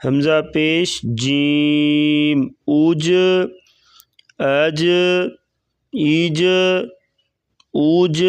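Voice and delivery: slow, measured spelling-out of each letter; qaida drill recitation. Shown as a demonstration. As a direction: chant the syllables as a teacher drilling children.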